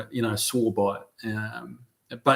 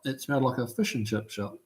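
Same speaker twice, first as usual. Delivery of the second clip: Kiwi vowels; 9 dB quieter than usual. Shown as a demonstration. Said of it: "Tavo's making fun of my Kiwi accent".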